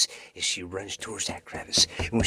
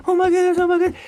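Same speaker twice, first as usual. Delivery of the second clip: frantic cries; 13 dB louder, much faster than usual. Acted. Girl running again.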